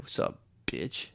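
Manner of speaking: low, whispered pimp greeting. Pretend.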